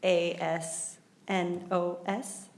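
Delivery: slow letter by letter spelling. Real, not acted. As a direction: spell each letter carefully. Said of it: "G L".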